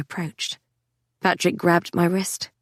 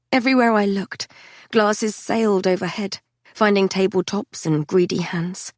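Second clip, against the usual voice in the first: ordinary delivery, nothing marked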